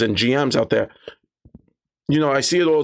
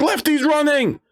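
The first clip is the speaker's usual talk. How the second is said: very high-pitched alert to the party; alarmed cry. Retold or imitated. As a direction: cry out high that the thief is escaping. Player alerts party to fleeing goblin.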